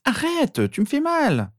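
put-on very high girl's cry; pained protest. A narrator's reading. Sister dragged by Harry, pained protest.

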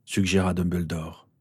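plain reading voice

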